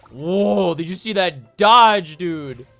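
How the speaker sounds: excited shout. Real, not acted. Excited gamer reacting to dodge.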